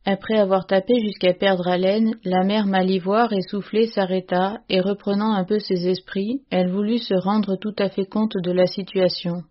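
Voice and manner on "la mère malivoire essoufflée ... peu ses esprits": ordinary delivery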